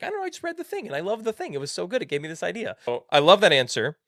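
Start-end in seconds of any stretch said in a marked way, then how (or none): 0.0-2.8 high-pitched excited gush; gushing enthusiasm. Retold or imitated. Husband answering his puzzled wife about a book, gushing excitedly. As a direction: gush like an eager fan defending himself.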